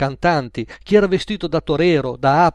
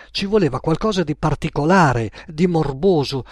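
ordinary delivery

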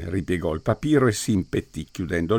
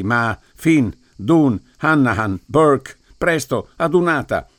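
louder, rising roll call; rallying call; 4 semitones higher, 5 dB louder, slower than usual. A narrator's reading. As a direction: summon the troops. Priest rallies his men.

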